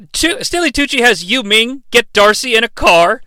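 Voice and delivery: ordinary talk, nothing marked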